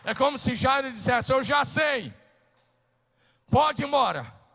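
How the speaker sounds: loud, high preaching that builds to a shout; fiery preaching. Acted. Pastor preaching at full force.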